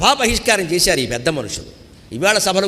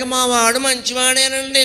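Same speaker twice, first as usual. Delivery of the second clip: excusing the son; 7 semitones higher, slower than usual. Retold or imitated. Parent excusing son to others.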